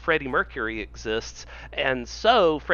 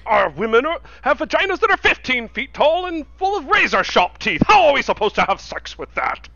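high, loud comic indignation; ranting cartoon character; 8 semitones higher, 6 dB louder than usual. South Park character protesting about the Gorgamax, outraged rant. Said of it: "That's from a South Park episode".

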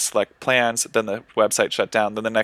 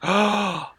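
shocked gasp; 10 semitones higher than usual. Real, not acted. Friend never saw classic movie.

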